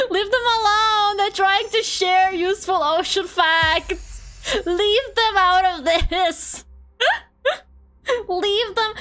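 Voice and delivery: high, loud, slow laughing protest; laughing mock outrage. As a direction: defend them, cracking up. Defends ocean facts jokingly.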